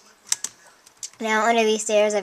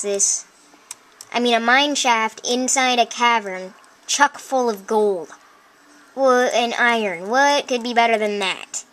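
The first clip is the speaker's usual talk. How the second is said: ordinary delivery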